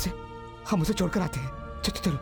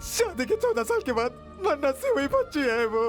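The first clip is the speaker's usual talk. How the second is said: sobbing plea; 3 semitones higher than usual. Acted. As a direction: beg through heavy tears. Sobbing plea for child's life. (रोते हुए गिड़गिड़ाना)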